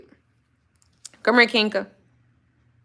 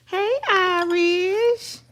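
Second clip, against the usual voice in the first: even higher, very slow coo; 11 semitones higher, much slower than usual. Real, not acted. Calling her dog Irish again, in an even higher, slower coo. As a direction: stretch out Irish in a high lilting coo.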